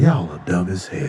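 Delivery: very low deadpan put-down; mock disdain. Ancients to baffled humans, mock disdain. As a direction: drop the voice deep for the verdict.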